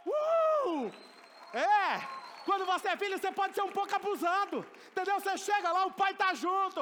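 high, loud, exultant preaching; shouted triumphant sermon peak; excited shout. Real, not acted. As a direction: burst out with joy and swagger.

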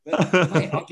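laughing through the words; chuckling at his own cheek. Real, not acted. Admitting to his mate he paid, laughing.

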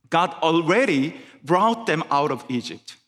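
ordinary talk

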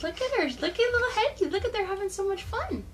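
high, sing-song cooing. Acted. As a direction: coo at the little bird. Cooing over the bird.

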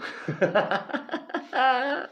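high amused laugh at his own bit. Real, not acted. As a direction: crack up at the joke. Laughs after his impression.

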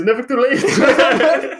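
slow, high, laughing movie line; dramatic movie quote. Acted. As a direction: ham up a famous film quote. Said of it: "dan is het Lord of the Rings".

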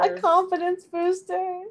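high and slow, laughing. Real, not acted. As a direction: laugh through the words. Mocking the easy question.

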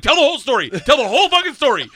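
high, loud demand, hammering it; insistent heckle. Retold or imitated. Friends in the back want all of it, insistent yelling. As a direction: imitate friends shouting him on.

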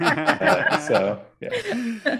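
high, laughing trail-off. Real, not acted. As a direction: laugh it out as the joke lands. Laughing after joke about quitting.

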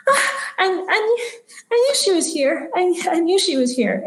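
put-on high-pitched, delighted little girl voice; excited little girl. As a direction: gasp with joy in a high child's voice. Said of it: "she starts giggling and smiling".

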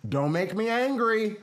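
loud, slow, high threat; mom's final warning. Acted. Mom's third warning to fighting kids, slow high holler. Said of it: "once you said the third time".

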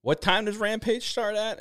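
high-pitched retold crowd question; asking the crowd. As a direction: call it up high, imitating someone polling a crowd. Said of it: "then asked the crowd".

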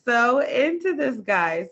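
high, stretched-out cry of disbelief; disbelieving wail. Retold or imitated. Looking back on an old crush, a slow high disbelieving wail. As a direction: wail it slowly, baffled by your past self. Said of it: "you think about it now and you're like".

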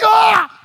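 sudden high, loud cry of alarm; shocked yelp. Retold or imitated. Pastor yelps at hearing the forbidden word, loud shocked cry. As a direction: yelp out loud as if a taboo was broken.

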